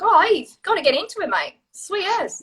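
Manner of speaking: put-on breezy high-pitched Kiwi accent; mimicking New Zealanders' casual accent; New Zealand accent. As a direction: toss it off like a laid-back New Zealander. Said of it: "Just there, I heard an accent".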